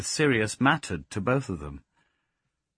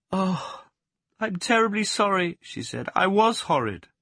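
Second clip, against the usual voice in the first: much higher, louder remorseful voice; anguished apology; 11 semitones higher, 4 dB louder than usual. Acted. Plaxi apologizing in distress to the dog.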